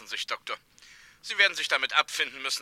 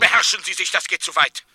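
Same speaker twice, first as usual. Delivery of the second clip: loud, higher, outraged; angry reprimand; 4 semitones higher, 9 dB louder than usual. Acted. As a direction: order him to control himself, loudly. Warden reprimands the doctor.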